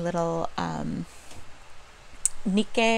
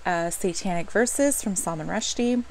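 normal talking voice

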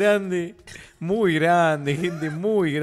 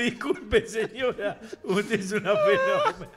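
higher voice, choked with laughter; retold apology, laughing; 5 semitones higher than usual. Marcelito apologizing to the lady, helpless laughing retelling. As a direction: barely get the imitated apology out.

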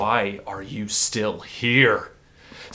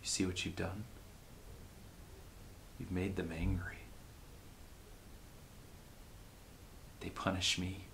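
softer, hollow despair; quiet dread; 10 dB quieter than usual. Acted. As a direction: murmur it in defeat. Dark forces now angered.

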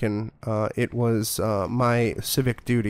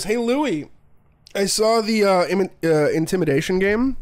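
imitated lead, pitched up; lead's teasing call; 8 semitones higher, 3 dB louder than usual. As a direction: hail the coworker in a higher voice. Acting out lead baiting coworker.

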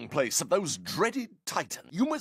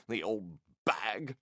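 muttered aside; 11 semitones lower, 6 dB quieter than usual. Acted. Muttered insult aside about Nana.

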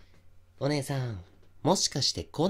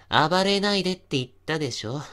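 stern warning; 5 semitones higher, 6 dB louder than usual. Acted. Restraining struggling woman.

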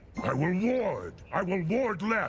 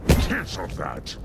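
deep, booming giant lord of fire; low rumbling Jotun voice with a gloating laugh; menacing fire giant; 9 semitones lower, slower than usual. Acted. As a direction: loom over the hero and threaten doom. Voice lines of fire giant Surtur.

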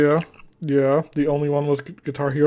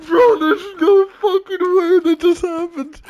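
disbelief; 10 semitones higher, slower than usual. Real, not acted. Disbelief after being killed.

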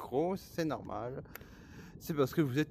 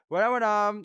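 exclamation forte; 8 semitones higher, 12 dB louder than usual. Real, not acted. Exclamation soudaine.

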